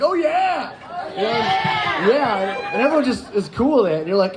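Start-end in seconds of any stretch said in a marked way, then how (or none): 0.0-2.1 high excited crowd echo; crowd cheering back. Retold or imitated. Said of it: "everyone's like".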